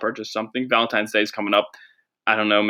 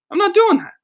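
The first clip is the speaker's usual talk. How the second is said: mock squeaky refusal; 5 dB louder than usual. Podcast host refusing DNA test, in a squeaky mock voice. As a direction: jump way up into a squeaky mock voice, louder.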